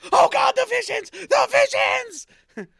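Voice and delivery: very high, loud drawn-out wail; hammed-up anguished scream. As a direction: cry out as if struck by visions. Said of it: "he'll randomly be like".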